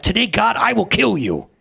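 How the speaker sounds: high, loud threatening cry; cried out. Acted. Lucifer cries out to God, loud and high. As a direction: Lucifer bellows his death threat. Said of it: "he cried out".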